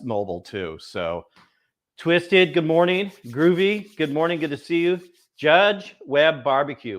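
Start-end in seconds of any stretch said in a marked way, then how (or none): 2.0-7.0 upbeat shout-outs. Real, not acted. Greeting chat viewers.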